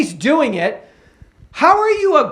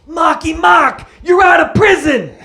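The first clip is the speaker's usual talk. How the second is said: loud, high Boston greeting; mock Boston holler at an ex-con; Boston accent; 6 semitones higher, 4 dB louder than usual. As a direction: call it out in thick Boston. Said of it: "it is me, [name]".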